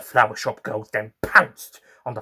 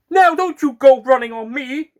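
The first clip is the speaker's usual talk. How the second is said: high, loud monster-plant voice; a hungry alien plant looming over its prey; menacing flytrap; 14 semitones higher, 11 dB louder than usual. Acted. Alien flytrap speaks to narrator.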